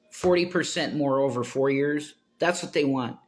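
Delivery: ordinary talk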